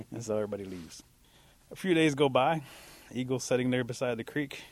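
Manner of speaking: usual speaking voice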